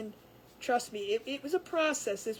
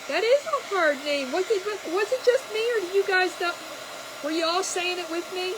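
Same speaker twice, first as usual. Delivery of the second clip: louder, higher, lively exclaiming; animated; 6 semitones higher, 6 dB louder than usual. Real, not acted. To the chat about the hard name, animated. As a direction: marvel at the difficult name.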